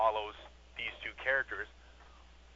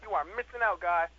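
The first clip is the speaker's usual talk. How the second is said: loud, hyped-up pitch to a friend; eager fan; 7 semitones higher, 4 dB louder than usual. Retold or imitated. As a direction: sell the show like an excited fan.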